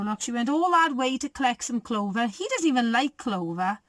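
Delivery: usual speaking voice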